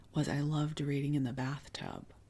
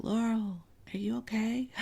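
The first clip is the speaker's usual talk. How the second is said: higher, lilting mock shout up the stairs; mom calling upstairs; 7 semitones higher than usual. Mom checks on daughter reading in the bathtub, calling up. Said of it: "shout up the stairs".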